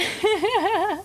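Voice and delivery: high, rolling giggle; real high laughter; laughing. Real, not acted. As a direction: laugh freely at the silly name. Amused by Jebediah name.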